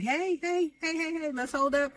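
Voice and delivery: high urgent hey hey hey to break it up; calling for calm. Retold or imitated. Kelly breaking up the heated fight, high urgent call. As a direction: jump in and stop the fight. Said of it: "Kelly's like".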